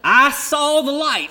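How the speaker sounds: "I saw the light" slow, high and loud; preacher crying out the moment of rescue, loud and slow; joyful proclamation. Acted. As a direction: proclaim it like a revival shout. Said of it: "had sung that".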